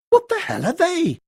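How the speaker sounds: high, startled outburst; shocked cry. A narrator's reading. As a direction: blurt it out in alarm. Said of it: "Keith cried".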